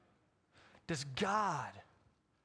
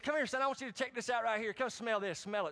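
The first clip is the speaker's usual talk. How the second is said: higher, eager coaxing dad; daddy calling; 8 semitones higher than usual. Retold or imitated. Dad calling his son to sniff ammonia, higher and eager. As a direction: coax the kid over with a trick. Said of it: "my daddy says".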